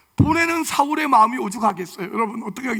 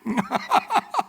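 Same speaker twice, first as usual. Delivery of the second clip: quick rising chuckle; short laugh; 2 semitones higher than usual. Real, not acted. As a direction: laugh lightly. Preacher laughs again. (가볍게 웃음)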